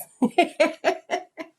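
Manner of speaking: high burst of laughter; loud laugh. Real, not acted. Laughs at own joke.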